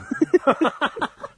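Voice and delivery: loud, high laugh that cracks up; high burst of laughter. Real, not acted. Laughing at partner's retort.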